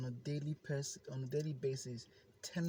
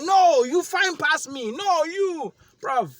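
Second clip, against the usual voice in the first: hype shout; 12 semitones higher, 14 dB louder, slower than usual. Retold or imitated. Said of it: "me and my mirror were hyping".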